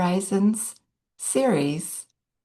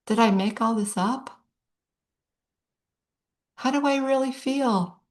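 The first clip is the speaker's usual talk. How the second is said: lightly voiced anxious inner questions, pitched higher; a hint of other women's self-doubt, higher; voicing women's worries; 4 semitones higher than usual. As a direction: quote their doubts in a slightly raised voice. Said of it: "I've heard women say things like".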